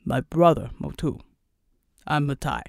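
low islander voice making introductions; big Samoan islander. Acted.

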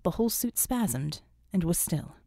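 usual speaking voice

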